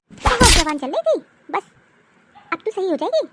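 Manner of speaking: loud, mocking triumph over her daughter-in-law; gloating mother-in-law, loud and pleased. Mother-in-law gloats after winning.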